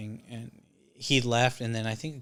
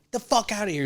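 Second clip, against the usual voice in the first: higher mock angry bark; angry dismissal; 9 semitones higher than usual. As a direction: throw him off the property. Dad throws him out.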